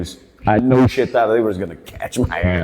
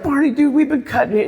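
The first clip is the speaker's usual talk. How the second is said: high squeaky voice. Retold or imitated. Comedian voicing himself high.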